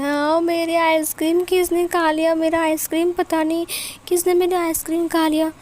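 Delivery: put-on a small girl wailing about her ice cream; little kid fussing over a lost treat; whiny little girl.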